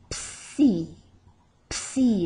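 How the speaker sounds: "Psi" with the P sounded right into the S; P-S-I together. Shown as a demonstration. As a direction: pop the P in "Psi", then see. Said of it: "pronounce the P, the S, and the I".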